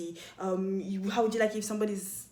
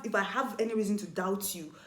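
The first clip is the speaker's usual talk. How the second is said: ordinary delivery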